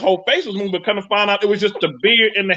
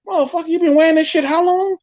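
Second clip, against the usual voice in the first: shocked outburst; 8 semitones higher than usual. Retold or imitated.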